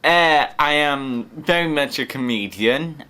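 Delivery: ordinary talk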